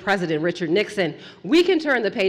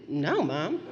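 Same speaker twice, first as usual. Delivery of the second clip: mock innocent lie; 5 semitones higher, 7 dB quieter than usual. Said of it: "Oscar-winning performance style".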